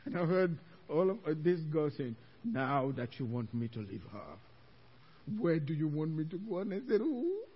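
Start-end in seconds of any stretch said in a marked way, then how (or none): 2.4-6.6 low, menacing demon; imitating a possessing demon's deep voice. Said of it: "'cause the deep voice". 7.0-7.4 high, startled cry; acted-out alarm; mock fright. Acts out fright at demon's question.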